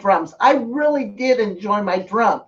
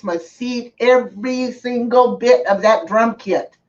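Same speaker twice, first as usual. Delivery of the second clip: normal talking voice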